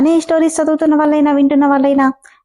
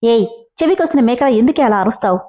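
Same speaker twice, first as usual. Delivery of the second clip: usual speaking voice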